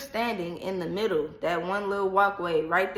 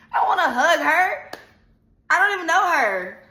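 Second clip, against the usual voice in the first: high, loud protest; 7 semitones higher, 4 dB louder than usual. Retold or imitated. Refusing to hug girlfriend.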